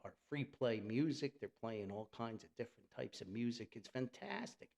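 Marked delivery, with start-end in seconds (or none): none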